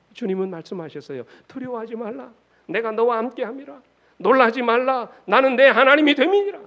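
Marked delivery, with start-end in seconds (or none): 1.5-6.6 high declamation building to loud; commanding divine promise. Acted. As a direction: proclaim it with rising force, pitched high. Preacher declaims God's promise.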